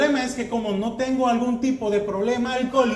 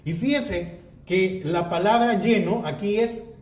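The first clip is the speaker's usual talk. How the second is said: usual speaking voice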